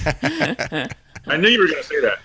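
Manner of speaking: slow, high, laughing through the words. Real, not acted. Laughing as she teases Bobby for his predictable joke. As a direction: chuckle it out, caught in a laugh. Teasing Bobby about Transformers.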